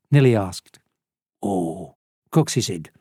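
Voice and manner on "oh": put-on low, soft, knowing old man; old smuggler. A narrator's reading. Old smuggler correcting Lisa about the bird, low and quiet. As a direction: lower it and play the expert. Smuggler begins modest boast.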